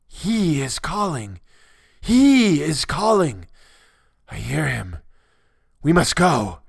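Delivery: high, drawn-out cry leaping up on HE; emphatic raving cry. A narrator's reading. Madman tells his commander of the call, emphatic crying out. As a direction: cry out with long, swooping emphasis. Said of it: "underlining the words he emphasized".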